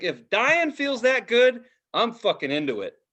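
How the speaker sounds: ordinary delivery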